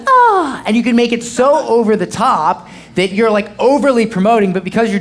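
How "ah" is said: amazed gasp. Retold or imitated. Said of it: "And then she's like".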